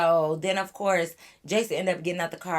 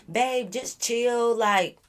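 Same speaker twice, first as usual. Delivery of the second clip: slow, soothing mock Freddie; imitating Freddie; 5 semitones higher, much slower than usual. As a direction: stretch it out, calming her down. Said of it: "Freddie end up butting in like".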